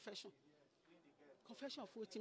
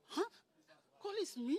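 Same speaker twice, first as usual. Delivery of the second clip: higher, louder bewildered huh; startled question; 9 semitones higher, 8 dB louder than usual. Retold or imitated. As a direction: act out Nancy's shock. Nancy startled by accusation.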